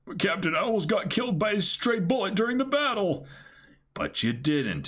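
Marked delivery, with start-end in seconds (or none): 0.1-3.3 high complaint. Acted. Voicing complaining crewmate.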